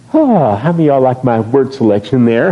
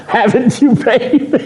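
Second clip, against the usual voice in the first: high laughing tease; laughing tease to wife; 10 semitones higher, slower than usual. Real, not acted. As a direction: ask her through laughter.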